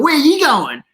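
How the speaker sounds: high, broad Australian accent, hammed-up; over-the-top Australian accent. Small kid greets passers-by from front steps, over-the-top Australian accent. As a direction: greet them in an exaggerated Aussie accent. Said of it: "this really over-the-top Australian accent".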